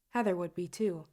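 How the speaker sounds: usual speaking voice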